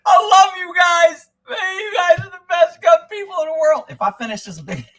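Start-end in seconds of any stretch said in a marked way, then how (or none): 0.1-3.8 put-on high, loud, blubbering drunk sob of love; drunk crying. Tipsy host gushing tearfully at his gun-loving viewers. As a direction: wail it like a weepy drunk hugging everyone. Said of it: "I'm gonna be crying saying".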